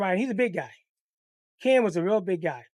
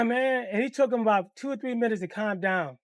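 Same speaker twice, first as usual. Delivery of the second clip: ordinary talk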